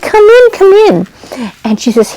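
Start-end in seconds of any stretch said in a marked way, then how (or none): 0.0-1.1 very high, louder, gushing welcome; high sing-song neighbor voice greeting a visiting girl; delighted neighbor lady. A narrator's reading. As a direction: greet her like an overjoyed old friend. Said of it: "She said".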